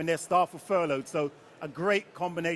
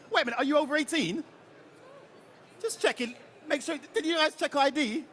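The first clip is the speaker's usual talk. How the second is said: high-pitched mock-suspicious questioning; joking alarm, voice jumping high; 9 semitones higher than usual. Real, not acted. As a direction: playfully challenge them, pitched well up. Jokes audience may be underage.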